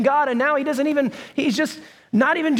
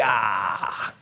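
reluctant groan; 5 dB quieter than usual. Retold or imitated. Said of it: "you're just like".

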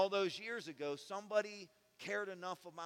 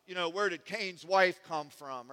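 loud, challenging mock heckler; mock skeptic; 3 semitones higher, 13 dB louder than usual. Preacher mimicking a skeptic's challenge, loud and mocking. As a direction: fire the ridiculous question back at him. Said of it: "If somebody says".

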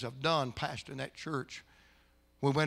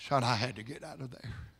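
normal talking voice

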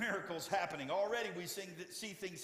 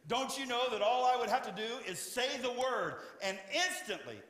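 higher and louder, proclaiming; Jesus declaring his power, higher and louder; preaching at full force; 8 semitones higher, 5 dB louder than usual. Acted. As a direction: drive home the power of the word.

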